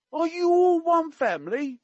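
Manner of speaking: very high, loud mock-Cockney question; attempted Cockney. Quoting the prince to a dance group, in attempted Cockney. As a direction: pitch it way up in would-be Cockney. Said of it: "I can't do Cockney. I will try my best".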